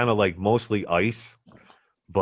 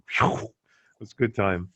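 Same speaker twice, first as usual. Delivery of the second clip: usual speaking voice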